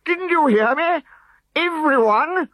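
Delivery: high, loud irritable snap; high loud exasperated snap; snapped. A narrator's reading. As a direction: snap at the idiots. Chief repeats order irritated.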